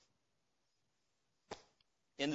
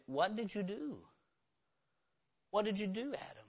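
much softer, grave question; quiet confrontation; 13 dB quieter than usual. Acted. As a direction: press him gently but firmly. God confronting Adam.

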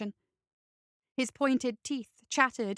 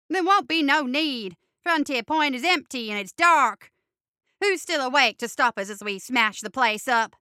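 gleeful battle cry; 7 dB louder than usual. Acted. Ray rallies for the attack.

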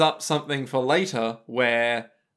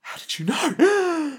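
mock shock; 12 semitones higher, much slower than usual. Acting shocked character.

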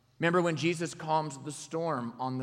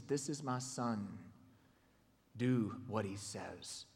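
low, slow and soft divine voice; solemn voice of God; 6 semitones lower, 9 dB quieter, slower than usual. Acted. God speaking from the cloud, low solemn. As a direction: speak with quiet authority. Voice of the Lord quoted.

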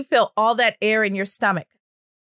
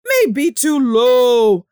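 slow, deep low-pitched demo; too low; 6 dB louder, much slower than usual. Shown as a demonstration. Speech therapist demonstrating a voice pitched too low. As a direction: drop low and drag it out. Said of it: "another quality of it is the pitch".

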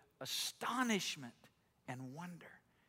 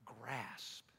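hushed; 4 semitones lower, 8 dB quieter, slower than usual. Retold or imitated. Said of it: "he says".

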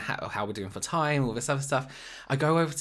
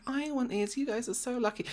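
very high imitation of an envious girl; mock her gushing over the kits; mimicked gushing; 13 semitones higher than usual. Imitates girl coveting others' kits.

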